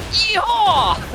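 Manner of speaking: high, whooping cowboy "Yeehaw"; cowboy yell. Retold or imitated. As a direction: holler it like a rodeo cowboy. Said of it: "that stupid cowboy-ass voice line".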